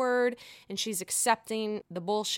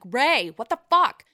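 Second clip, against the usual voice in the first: exasperated outburst; 2 semitones higher, 8 dB louder than usual. Retold or imitated. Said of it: "I'm like".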